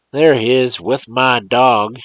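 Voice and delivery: loud, high, slow triumphant cry; cried out. Acted. Old man spotting the boy with his dog, crying out loudly. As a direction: pounce on the boy with it. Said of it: "cried the old man".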